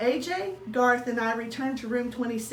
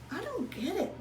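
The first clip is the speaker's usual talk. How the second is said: softer, higher puzzled mutter; 7 semitones higher, 6 dB quieter than usual. Acted. Husband puzzles over the TV, soft puzzled mutter. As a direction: grumble it to yourself, puzzled. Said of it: "Mister Thomas muttered".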